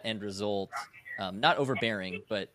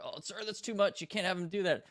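higher pitched, pleading protest from a junior soldier; whiny subordinate; 10 semitones higher than usual. Retold or imitated. Commander mocking his soldiers' complaints, whiny objecting voice. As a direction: mimic a soldier begging you to ease up.

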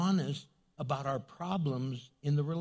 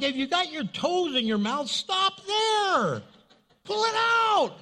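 high, loud comic shouting; mock alarm; 12 semitones higher, 6 dB louder than usual. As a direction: yell it high, as if urgently stopping someone. Mock alarm at foot in mouth.

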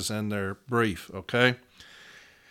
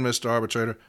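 normal talking voice

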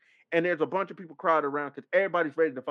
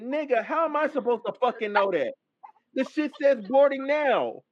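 raised, exasperated protest, slowing at the end; indignant retort; 5 semitones higher than usual. Retold or imitated. Traveler retelling his retort to the gate agent, indignant. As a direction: argue back in exasperated disbelief.

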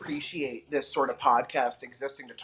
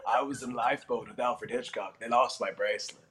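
Tallulah Bankhead; 3 semitones lower than usual. Retold or imitated. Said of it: "a Tallulah impression".

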